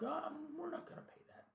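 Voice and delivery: high, soft breathless corporate refusal; mock Google voice. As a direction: squeak it out like a faceless company.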